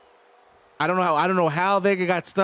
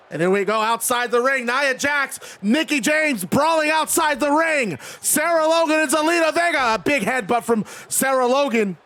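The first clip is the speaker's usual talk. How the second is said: higher, driving announcer call; live wrestling play-by-play; 6 semitones higher than usual. Acted. Streamer calls a brawl outside the ring, excited play-by-play. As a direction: call the brawl like a ringside commentator.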